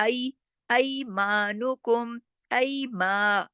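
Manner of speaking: slow ऐमानुकुम with gliding ऐ; two-count maa. Shown as a demonstration. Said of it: "मा दो हरकत खींचेगा". (ऐमानुकुम में मा खींचकर)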